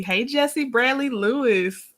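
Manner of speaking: ordinary delivery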